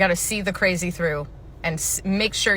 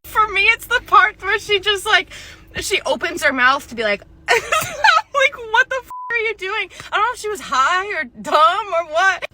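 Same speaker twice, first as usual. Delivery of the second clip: high and louder, words squeezed through laughter; laughing while talking; 12 semitones higher, 6 dB louder than usual. Real, not acted. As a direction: crack up as you tell it. Laughing over the memory.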